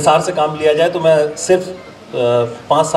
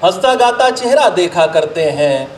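measured, declaimed Urdu couplets with pauses; ghazal recitation; 4 semitones higher than usual. Acted. Poet presenting a ghazal to an appreciative gathering, declaimed recitation. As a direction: recite verse to a mushaira audience, line by line.

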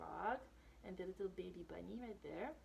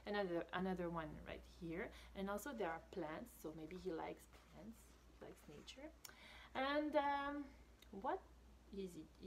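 ordinary delivery, nothing marked

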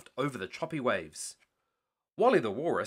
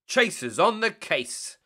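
louder, higher, slowed call; shouted; 6 semitones higher, 5 dB louder, slower than usual. Acted. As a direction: announce it proudly, a bit slow. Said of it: "Chase shouted".

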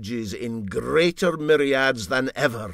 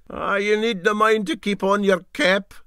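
higher, louder sly jibe; captain teasing; 12 semitones higher, 8 dB louder than usual. Acted. Captain mocking the engineer's cap, sly jibe. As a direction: needle him with mock advice. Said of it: "said the captain".

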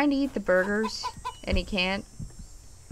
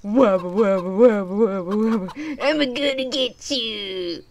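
playful wobbling monster babble; silly creature voice; 2 semitones lower than usual. Pretend. Player making legless creature come to get someone, silly monster voice. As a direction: tease with a goofy monster voice.

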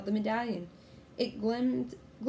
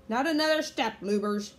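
loud, raised warning; pirate threat; 3 semitones higher, 6 dB louder than usual. A narrator's reading. Captain Bones aiming pistols at kids, loud threat. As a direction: order them to stay put.

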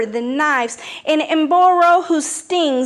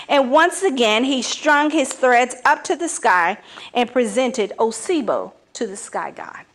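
plain reading voice

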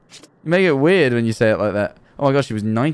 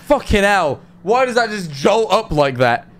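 startled; 7 semitones higher than usual. Real, not acted. Startled by a body jolting up.

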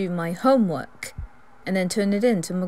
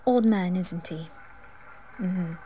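ordinary delivery

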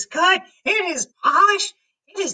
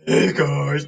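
low, put-on manly girl greeting. Shown as a demonstration. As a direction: play a girl with a man's low voice. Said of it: "this manly girl voice".

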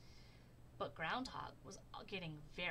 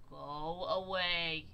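slow, loud bear growl; 3 semitones lower, 8 dB louder, much slower than usual. A narrator's reading. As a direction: drag it out like a cross bear. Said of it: "he growled".

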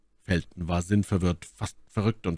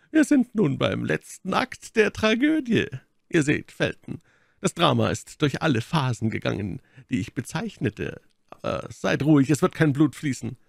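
high, ironic baron's voice; mock the drama as its spectator; Baron, spöttisch; 8 semitones higher than usual. The Baron mocks them. (spöttischer Baron, erhöhte Stimme)

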